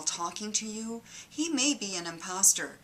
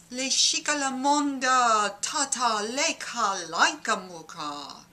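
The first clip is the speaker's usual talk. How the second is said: louder, higher mock babble in tongues; speaking in tongues; 4 semitones higher, 4 dB louder than usual. Comedian imitating a false god talking in tongues. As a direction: gush nonsense syllables like a possessed preacher. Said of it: "sounds like this".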